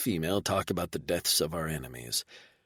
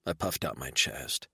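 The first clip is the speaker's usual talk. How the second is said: plain reading voice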